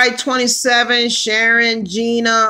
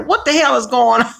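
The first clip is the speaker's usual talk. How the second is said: ordinary talk, nothing marked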